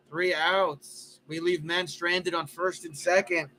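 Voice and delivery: lively baseball play-by-play; play-by-play call. Acted. Announcing his ballgame to friends, live broadcaster style. Commentates his video game.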